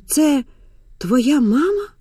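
high, rising disbelief; shocked question. Acted. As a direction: ask incredulously, pitch climbing. Потрясённая дочь переспрашивает мать.

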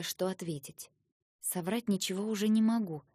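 plain reading voice

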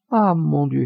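high, wailing lament with a hint of laughter; half crying, half laughing. A narrator's reading. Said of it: "moitié pleurant et moitié riant".

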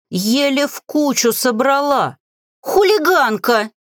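high, indignant squeal; screechy cry. A narrator's reading. Grimoire to the witch, screechy indignant cry. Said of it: "визгливый крик следом".

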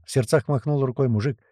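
ordinary delivery, nothing marked

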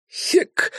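breathless unvoiced grunt; winded grunt. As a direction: gasp as the wind is knocked out. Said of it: "вякнул тот".

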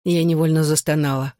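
ordinary delivery

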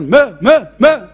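loud, repeated cries of protest; short loud bursts, as if hushing someone; imitated shouting. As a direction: mimic a crowd shouting someone down. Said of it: "diye bağırmaya başlamışlar".

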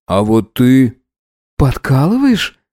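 slow, suspicious question. Acted. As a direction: ask warily, half accusing. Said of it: "с подозрением спросила Таня".

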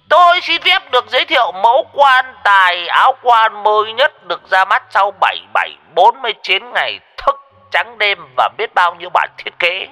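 put-on old drunk selling a coffin like a showman; mock ceremonial speech. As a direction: ham up a grand salesman's pitch.

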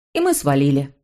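ordinary delivery, nothing marked

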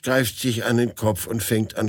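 ordinary delivery

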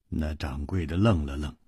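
ordinary delivery

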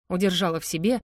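plain reading voice

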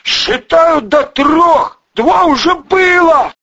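high, loud, slow countdown yell; shouted ultimatum. A narrator's reading. Cornered Volyna gives final count, shouting. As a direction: bellow it as a last warning.

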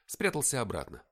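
ordinary delivery

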